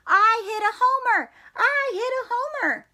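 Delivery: high, proud holler. Acted. Froggy hollering to his family, high and proud. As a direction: shout the news home. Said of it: "he hollered".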